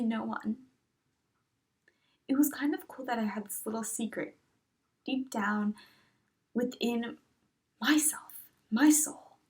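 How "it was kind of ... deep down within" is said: ordinary talk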